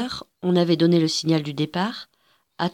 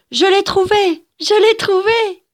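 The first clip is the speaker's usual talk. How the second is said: high, loud, joyful shout; overjoyed cry; 14 semitones higher, 8 dB louder than usual. A narrator's reading. Little girl announcing the egg she found, joyful high shout. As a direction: cry out your find, wild with joy. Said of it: "elle se mit à crier".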